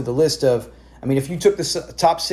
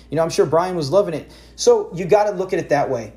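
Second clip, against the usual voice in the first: normal talking voice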